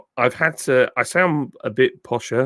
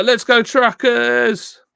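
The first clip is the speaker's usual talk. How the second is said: higher, louder, slow rallying cry; stretched-out cheer; 11 semitones higher, 5 dB louder, much slower than usual. Real, not acted. Streamer cheering the truckers along with chat, rally chant.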